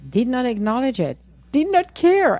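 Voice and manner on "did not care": indignant. Real, not acted. Indignant about the widower's indifference.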